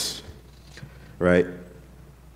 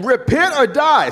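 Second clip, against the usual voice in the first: street preacher; 11 semitones higher, 4 dB louder, slower than usual. Retold or imitated. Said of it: "his whole thing".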